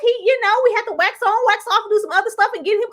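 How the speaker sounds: high, loud, fast indignant defence; heated defence. Real, not acted. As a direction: argue it like it's personal.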